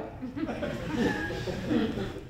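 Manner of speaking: high, stunned laugh; disbelieving laugh. Real, not acted. Recalling the bar owner's pitch, a stunned laugh. Reacting to beer name.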